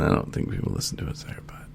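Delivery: quick, hushed joking aside; a whispered, fast throwaway line. Real, not acted. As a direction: drop to a whisper for the joke.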